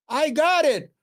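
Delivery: mock English accent. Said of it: "'Cause she has an English accent".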